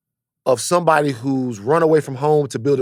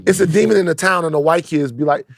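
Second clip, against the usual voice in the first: ordinary talk, nothing marked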